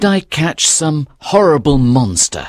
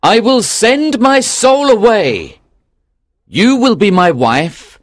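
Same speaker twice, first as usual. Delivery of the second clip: louder, excited fisherman voice; joyful cry; 5 dB louder than usual. Acted. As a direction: call out to her in joy. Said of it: "He stood up in his boat and cried".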